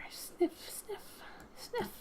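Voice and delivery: soft, high playful sniffs; mock sniffing. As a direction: sniff the air like a curious animal. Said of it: "You sniff the air".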